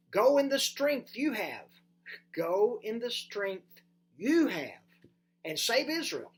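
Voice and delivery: loud, high firm command; commanding Lord. Acted. The Lord commanding Gideon in a loud firm voice. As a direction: send him out with force.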